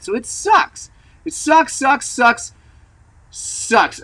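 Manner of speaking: high, repeated whining sucks; whiny complaint. Real, not acted. Rider venting to viewers about his unridable bike, high whine.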